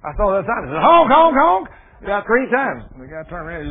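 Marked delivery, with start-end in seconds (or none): 0.8-1.7 high, loud mock honking; silly clown. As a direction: honk it out like a goofy car horn. Honking at the sign.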